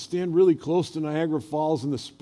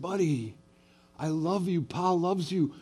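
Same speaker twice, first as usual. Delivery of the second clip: put-on softer, hushed comfort to a child; gentle softer words to the grandson; tender reassurance; 3 semitones higher, 4 dB quieter than usual. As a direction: soothe him after the scare. Hugging the grandson after the bite.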